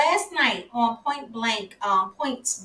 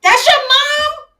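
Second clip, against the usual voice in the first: much higher, louder, slow astonished squeal; 9 dB louder, slower than usual. Real, not acted. Host hearing the guest's mom is in the chat, squealing in delight. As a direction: shriek it in delighted disbelief.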